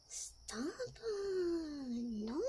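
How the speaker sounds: high, drawn-out falling whine; mock whining protest. Imitating somebody for a running joke, mock whining protest. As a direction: stretch each word into a playful whine. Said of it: "when somebody's like".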